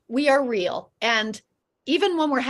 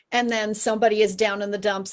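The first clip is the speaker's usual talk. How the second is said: ordinary talk, nothing marked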